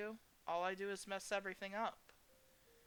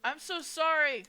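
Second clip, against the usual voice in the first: higher and louder, slow nervous apology; flustered blurt; 10 semitones higher, 11 dB louder than usual. Acted. Sam apologizing to Lorelei, nerves take over, loud. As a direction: blurt the apology out too hard. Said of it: "so much for calm and composed".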